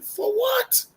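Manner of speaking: very high, stunned question; incredulous squeak. Retold or imitated. Young soldier asks why he is arrested, squeaky and stunned. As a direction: squeak it out in disbelief.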